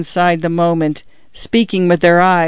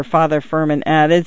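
ordinary delivery, nothing marked

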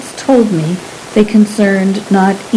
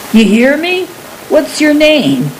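higher-pitched, demanding roar at a silent listener; 7 semitones higher than usual. A narrator's reading. Wild man to frightened stranger, roaring. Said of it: "The roar of him".

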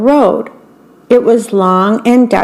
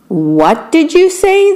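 shocked; 8 semitones higher than usual. Acted. Beetles shocked at Grasshopper.

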